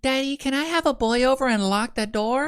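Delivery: put-on high whiny teen girl voice; whiny daughter. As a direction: ask sweetly like a pleading daughter. Daughter's voice imitated.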